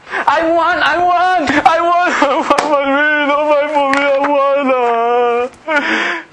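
high, drawn-out wailing demand; hammed-up whining that stretches into a wail; child's tantrum. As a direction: mimic a bawling child demanding things. Preacher mimics child's tantrum.

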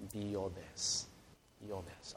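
low, soft "your best"; gentle emphasis. Acted. Preacher giving God's emphasis to listeners, hushed. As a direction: drop right down and say it quietly. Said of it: "You know what's God's emphasis?".

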